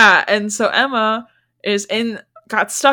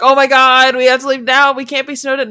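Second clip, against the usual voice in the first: panicked, loud; 5 semitones higher, 7 dB louder than usual. Retold or imitated. Guests panic about snow.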